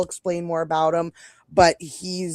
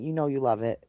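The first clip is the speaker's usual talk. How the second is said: ordinary talk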